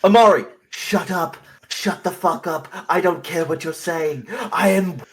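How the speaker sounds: loud, high-pitched triumphant rant; indignant outburst. Acted. Oswald silencing Amari to declare victory, loud and indignant. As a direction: shut them down and proclaim your win. Acted angry boast at companion.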